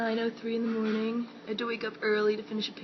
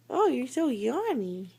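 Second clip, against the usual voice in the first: ordinary talk, nothing marked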